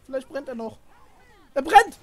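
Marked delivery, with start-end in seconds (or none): 1.5-2.0 loud urgent shouting; being chased. Real, not acted. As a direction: yell at them to run. Urging burning teammate to run.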